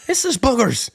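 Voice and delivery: squeaky high put-on wife voice, exasperated; high mock Cindy voice. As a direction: play a whiny high-pitched mom, fed up. Continues Cindy voice.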